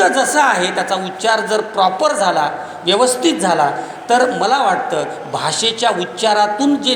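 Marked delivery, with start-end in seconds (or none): none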